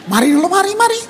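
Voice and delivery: high-pitched coaxing call, rising; God calling. Acted. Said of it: "pasal enam untuk panggil tuh". (memanggil dengan nada tinggi)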